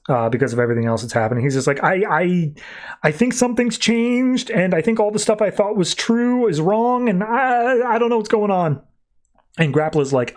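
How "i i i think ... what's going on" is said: higher-pitched, stammering mock fright; imitated panic. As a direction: act out a scared man faltering over his words, voice pitched up. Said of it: "who's literally pissing his pants".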